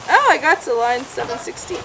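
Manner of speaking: ordinary talk, nothing marked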